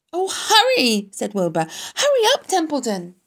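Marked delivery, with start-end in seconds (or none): 0.1-1.1 high, loud cry; urgent plea. A narrator's reading. Wilbur begs the dawdling rat, urgent plea. 2.0-3.2 very high, loud hurrying cry; urgent plea. A narrator's reading. Wilbur hurries the idle rat, urgent plea.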